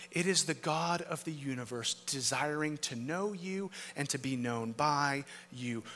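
ordinary talk, nothing marked

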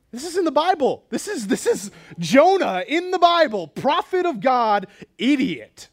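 high, loud exasperated exclamation; incredulous outburst. Real, not acted. Preacher exclaims over Jonah's outburst.

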